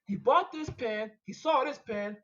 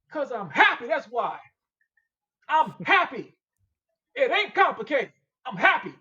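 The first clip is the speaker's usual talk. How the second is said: emphatic loud insistence; 9 dB louder than usual. Real, not acted. Insisting he is happy.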